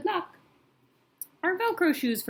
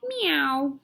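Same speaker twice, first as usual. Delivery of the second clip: cat meow; 6 semitones higher, 4 dB louder than usual. Acted. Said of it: "her cat says".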